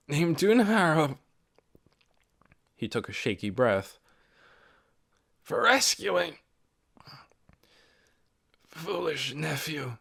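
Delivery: put-on weak old man. A narrator's reading. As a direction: force each word out through weakness. Weak dying old man speaks.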